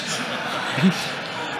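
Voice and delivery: soft, airy laugh; real quiet chuckling; amused laugh. Real, not acted. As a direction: laugh quietly at what you see. Laughing at the video.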